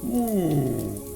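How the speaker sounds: ordinary delivery, nothing marked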